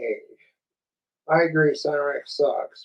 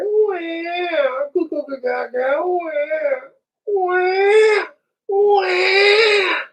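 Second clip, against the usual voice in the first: mock baby wailing, much higher, building to loud wahs; crying baby; 13 semitones higher, 4 dB louder, much slower than usual. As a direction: bawl like a bratty infant, goo-goo babble between cries. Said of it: "They're like Cyrax in your".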